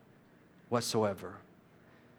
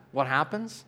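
ordinary delivery

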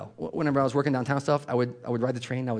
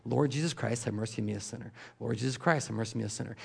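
soft murmured repetition; murmured prayer; 2 semitones lower, 7 dB quieter than usual. Shown as a demonstration. Preacher showing the prayer said continually, softly murmured. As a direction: murmur it over and over. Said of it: "using their vocals".

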